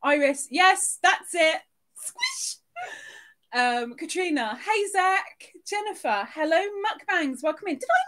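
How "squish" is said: very high, squeaky cry; squeaky squish. Pretend. Streamer squeaking the emoji sound for chat. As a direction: squeak it like a squeezed toy.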